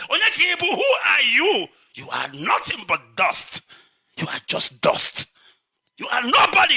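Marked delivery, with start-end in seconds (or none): 0.1-1.7 indignant challenge. Real, not acted. Confronting the egoist. 4.2-5.3 hushed scorn. Real, not acted. Scorning the egoist. 6.0-6.8 scornful shout. Real, not acted. Telling the proud man he is nothing, shouting.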